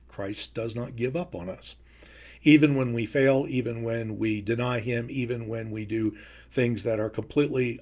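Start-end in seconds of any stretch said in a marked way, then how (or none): 0.1-1.7 much softer, almost under the breath; hushed repeat. Acted. Preacher echoing himself to the congregation, hushed. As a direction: drop to a hush to let it sink in.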